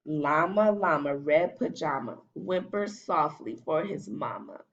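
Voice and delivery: usual speaking voice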